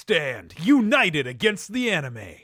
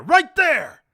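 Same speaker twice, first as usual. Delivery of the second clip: higher, louder right there, hitting right; urgent repeat; 7 semitones higher, 6 dB louder than usual. Acted. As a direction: jab the word right as you point.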